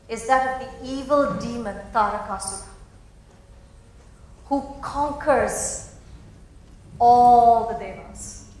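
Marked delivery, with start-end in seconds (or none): 4.5-8.5 slow and much louder on all the devas; dramatic emphasis. A narrator's reading. Storyteller builds the demon's menace, slow and loud. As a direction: lean hard into the conquest.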